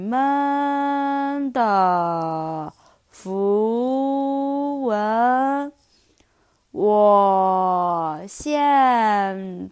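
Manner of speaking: very slow, drawn-out sloth voice; super slow sleepy sloth. Acted. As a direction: stretch every word like a sleepy sloth. Sloth speaks again.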